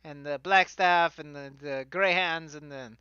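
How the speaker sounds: hammed-up fantasy Italian accent; bad stage Italian for a fantasy character; fake Italian accent. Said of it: "bad, fake fantasy Italian accent".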